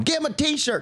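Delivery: high-pitched imitation of a pushy fan; pleading dad. Father begging for his boy's t-shirt, high pleading. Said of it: "His dad kept going".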